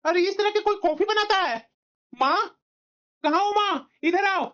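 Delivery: high, irritated call for mother; angry yelling. Acted. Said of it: "इस तरह से तुम चिल्ला क्यों रहे हो?".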